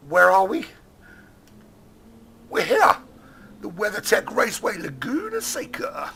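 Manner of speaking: weird-sounding. Real, not acted. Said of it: "That sounded so weird".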